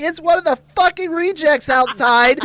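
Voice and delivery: high, loud imitation of a fed-up mom; exasperated yell. Mom spotting who threw the football, retold exasperated yell. Mom yelling from window, acted.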